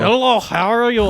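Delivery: mock Russian greeting. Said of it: "if I can do a Russian voice".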